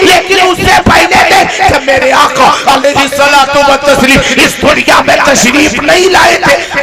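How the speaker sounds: loud sermon, building up; full-force preaching. Acted. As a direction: preach it out with rising fervour. (पूरे जोश में तक़रीर)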